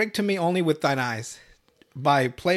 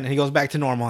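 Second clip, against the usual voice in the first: ordinary talk, nothing marked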